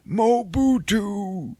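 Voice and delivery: high, slow mock Saw saying "Mobutu" with a falling close; Forrest Whitaker's cryptic word. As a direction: intone "Mobutu" like a mysterious password. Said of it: "Forrest Whitaker just says some word like".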